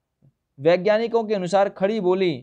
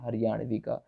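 ordinary talk

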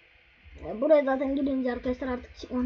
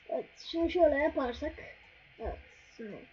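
ordinary talk, nothing marked

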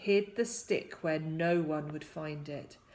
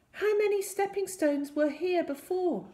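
high, eager storybook question; urgent young hero; 11 semitones higher than usual. A narrator's reading. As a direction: ask urgently, pitched well up. Hero questions the stranded boy.

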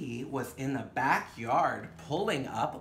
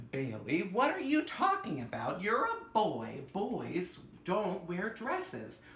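dismissive mother; 5 semitones higher, slower than usual. Acted. Mother scolds child in story.

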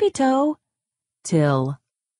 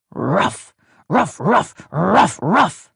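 rising, louder woof-like ruffs; dog barking; 3 semitones higher, 3 dB louder, slower than usual. Acted. Grumpy dog barks at the chicks, loud and rising. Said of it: "Dog disagreed, barking".

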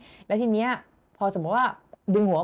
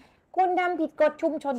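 mock robotic notice voice; 8 semitones higher than usual. ไลฟ์แจ้งเตือนแบบล้อเลียน.

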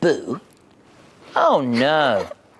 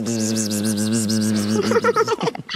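low, steady, continuous buzz; bee buzzing; 7 semitones lower than usual. Acted. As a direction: buzz on one held note like a bee. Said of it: "A bee goes".

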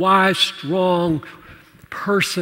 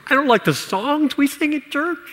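higher-pitched imitation of a reluctant kid; kid's complaint; 8 semitones higher, 5 dB quieter than usual. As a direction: lightly voice a child's hesitant complaint. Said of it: "As a kid".

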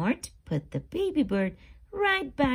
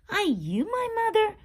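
high, loud, fast chick question; baby bird voice; 10 semitones higher, 4 dB louder, faster than usual. Acted. Baby bird asks the hen, high and quick. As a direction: ask it eagerly like a tiny bird. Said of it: "the baby bird asked the hen".

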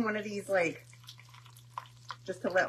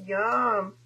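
ordinary talk

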